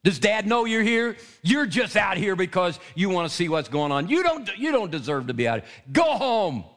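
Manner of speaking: a mocking, angry brother's rebuke; angry big brother. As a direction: taunt him like a sneering big brother. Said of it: "he was angry".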